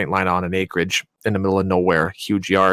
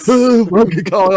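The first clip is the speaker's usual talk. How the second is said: high, loud panicky answer; shocked waking voice; 10 semitones higher, 5 dB louder than usual. Retold or imitated. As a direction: act out jolting awake from a nightmare. Acting himself waking in shock.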